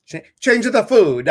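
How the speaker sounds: loud, high hammed-up Italian accent bursting out. Pet psychic's advice redone in a big Italian accent. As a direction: belt it like a hammy Italian. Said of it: "you were talking in that Italian voice".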